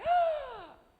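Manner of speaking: a huge high gasp of shock; loud high starstruck gasp. Retold or imitated. Meeting Tim Gunn, loud high gasp.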